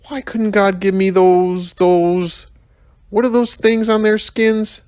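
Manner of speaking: high put-on young rhino voice, searching for a word; whiny kid rhino groping for the word; Mac the rhino muttering. A narrator's reading. Rhino complaining to the narrator, high and whiny. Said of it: "Mac mused".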